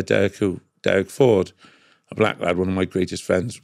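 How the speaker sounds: usual speaking voice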